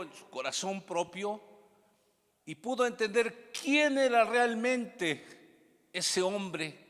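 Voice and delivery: high, loud pulpit delivery; ringing, forceful sermon voice; impassioned preaching. Acted.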